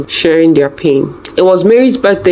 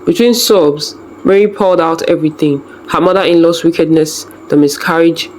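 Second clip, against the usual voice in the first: ordinary delivery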